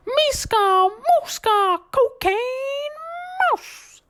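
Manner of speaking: high squeaky cartoon shout; Mickey Mouse scream. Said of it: "scream off the top of his lungs".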